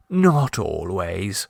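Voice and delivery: low, very slow soothing reply; consolingly. A narrator's reading. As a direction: reassure her gently and slowly. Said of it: "said Clovis consolingly".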